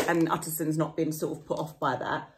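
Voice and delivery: ordinary talk, nothing marked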